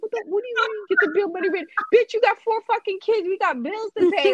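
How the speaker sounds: high, exasperated retold comeback; indignant father. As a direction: mimic an outraged dad arguing back. Retold parents' quarrel over bail.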